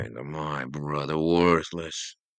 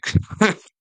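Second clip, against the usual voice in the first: sharp, high laugh that hurts; pained laugh; 13 dB louder than usual. Acted. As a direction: laugh through the pain of a wound. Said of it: "hurts to laugh".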